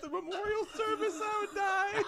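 high, squealing laugh-talk; real uncontrollable laughing; helpless laughter. Real, not acted. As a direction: try to speak while cracking up. Cracking up imagining the service.

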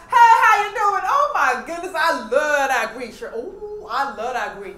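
loud, hammed-up American accent; imitated American accent. Shown as a demonstration. Guest greets host in mock small talk, exaggerated American accent. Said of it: "ano pang mga accent ang kayang gawin".

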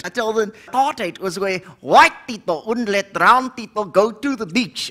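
ordinary delivery

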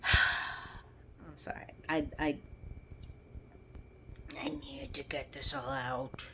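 soft, slow, whispered apology; weary whisper; sighing whisper. Real, not acted. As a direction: breathe it out, drained.

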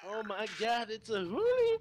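very high, loud squealed greeting; hammed-up excited shout. Player greets Julian turning up, hammed-up high shriek. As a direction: squeal it at the top of your voice.

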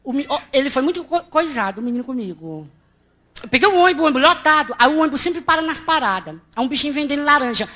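ordinary talk

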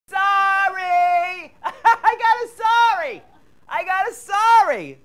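loud high triumphant cry; slow gloating cackle of delight; gleeful shout. Acted. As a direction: crow over the win. Gloating over board game.

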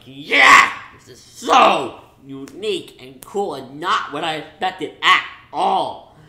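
big excited shout, then gushing; excited cheer. Retold or imitated. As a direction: cheer like the twist just hit. Retold reaction to reveal.

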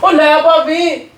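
a slow, high teasing "ਉਹ ਲੈ ਭਾਬੀ"; teasing drawl. Acted. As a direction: tease her with a drawn-out, high call. Teasing reply to angry bhabhi.

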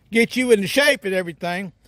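ordinary talk, nothing marked

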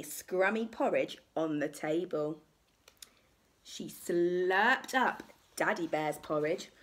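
ordinary delivery

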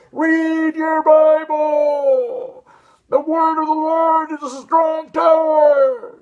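fiery preacher shout. Retold or imitated. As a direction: bellow it like a street preacher at full force. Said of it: "And someone says".

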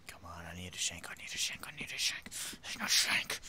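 hushed, repeated pleading whisper; urgent whisper. Pretend. Prisoner hunting for a shank, urgent whisper. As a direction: whisper it like a desperate wish. Searching for weapon in jail.

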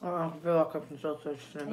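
chewing while talking; talking around a biscuit; mouth full. Real, not acted. Seen 2: Left speaker lifts biscuit to mouth, lips pursed, chewing while talking.

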